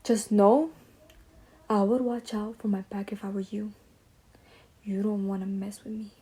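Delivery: imitating a customer's quiet threat; menacing whisper. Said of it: "Let me just show you how crazy he was acting".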